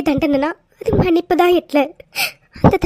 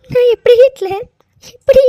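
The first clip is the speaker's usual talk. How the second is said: high, loud, breaking how; desperate cry; 5 semitones higher, 6 dB louder than usual. Acted. Wife to husband, afraid he'll go to jail, desperate cry. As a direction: plead in panic.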